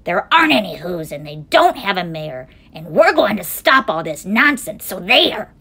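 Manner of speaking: loud, scornful monkey brothers; shouting. A narrator's reading. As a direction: shout them down indignantly. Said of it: "came shouting".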